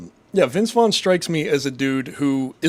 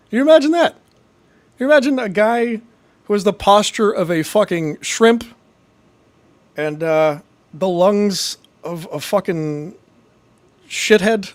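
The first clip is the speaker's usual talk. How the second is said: indignant rant; 6 semitones higher, 4 dB louder than usual. Real, not acted. Ranting at a hater's comment.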